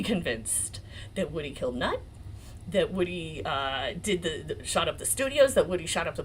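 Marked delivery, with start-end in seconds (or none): none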